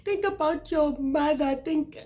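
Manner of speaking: high, whiny mock scolding; mocking scolders. Mimicking people who call her selfish. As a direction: parrot the nagging in a shrill-pitched voice. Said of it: "when people are like".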